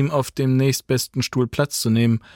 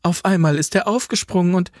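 higher and fast, words tumbling out; excited; 8 semitones higher, faster than usual. A narrator's reading. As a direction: blurt it out nervously. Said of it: "sagte die Enkelin aufgeregt".

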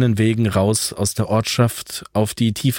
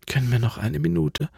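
higher, softer, exhausted asking for a rest; tired plea; 6 semitones higher, 5 dB quieter than usual. Acted. Alva, exhausted, asks Franz for a short rest. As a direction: beg for a minute, worn out. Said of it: "bat Alva".

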